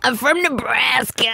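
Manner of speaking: mock American accent. Co-host mimicking guest, slow American accent impression. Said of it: "not a single twang of British".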